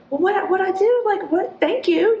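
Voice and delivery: high, stammering bewildered thanks; stunned by a stranger's praise; flustered disbelief. Retold or imitated. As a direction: sputter it out, unable to believe it. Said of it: "I was kind of like".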